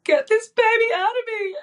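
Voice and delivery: retold plea to God in labor; crying out. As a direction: beg desperately at the top of the voice. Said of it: "crying out to God and saying".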